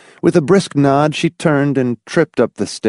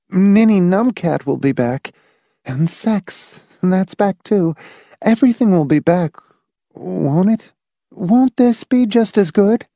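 high, anxious, shaken woman's voice; shakily; 11 semitones higher than usual. A narrator's reading. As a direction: seek reassurance, badly shaken, pitched high. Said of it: "Marcia said shakily".

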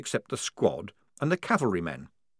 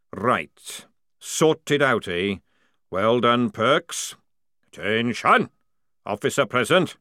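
loud barking drill sergeant; booming sergeant; 2 semitones higher, 5 dB louder, slower than usual. Acted. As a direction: call the lads to order. Jackram voiced.

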